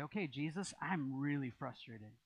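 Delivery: kind, quiet prayer; polite prayer. Retold or imitated. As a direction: say it gently to Jesus. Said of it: "very polite way and kind way".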